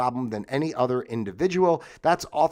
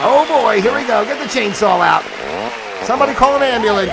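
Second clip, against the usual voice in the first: loud, higher, hammed-up alarm over a chainsaw mishap; mock panic; 9 semitones higher, 10 dB louder than usual. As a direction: yell for help like a cartoon klutz.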